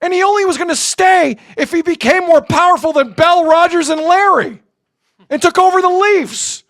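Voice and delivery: high, slow, exasperated rant; heated rant, pitch way up; angry rant. Real, not acted. Rant at chat about Kawhi.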